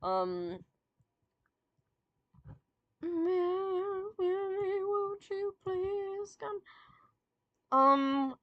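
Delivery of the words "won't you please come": ordinary delivery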